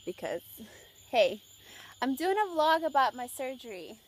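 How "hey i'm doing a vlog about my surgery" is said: calling out. Real, not acted. Calling out to someone nearby.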